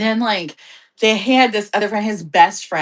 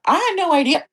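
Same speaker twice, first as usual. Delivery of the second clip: hiccups; 8 semitones higher, faster than usual. Real, not acted. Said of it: "I have the hiccups, guys".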